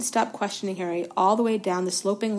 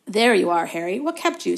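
louder, brisk team captain; 9 dB louder than usual. A narrator's reading. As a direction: snap it out briskly, all business. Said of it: "said Wood briskly".

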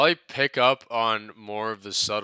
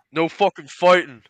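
put-on Birmingham gangster accent, muttered then loud with a laugh; mock Thomas Shelby growl turning into a laughing shout; Peaky Blinders accent; 8 semitones higher, 14 dB louder than usual. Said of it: "give me your best Peaky Blinders".

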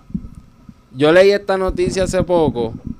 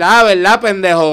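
taunting; 4 semitones higher, 7 dB louder than usual. Retold or imitated. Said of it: "yo le empecé a decir".

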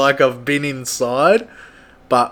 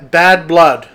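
ordinary talk

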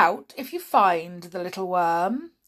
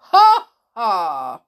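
loud mocking ha ha; gloating villain laugh; 10 dB louder than usual. Headmaster gloating over burnt letters, loud laugh. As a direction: cackle in triumph.

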